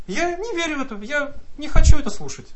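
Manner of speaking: normal talking voice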